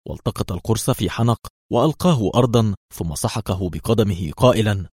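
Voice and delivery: usual speaking voice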